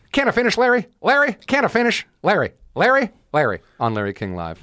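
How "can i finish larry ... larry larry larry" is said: high-pitched, pestering politician impression; Dana Carvey doing Ross Perot. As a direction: keep interrupting in a high comic caricature voice. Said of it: "Dana Carvey doing Ross Perot".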